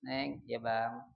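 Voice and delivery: unhurried, tender address; calling from close by. Shown as a demonstration. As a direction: call your spouse gently from nearby. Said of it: "manggil dari dekat".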